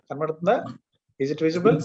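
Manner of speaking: usual speaking voice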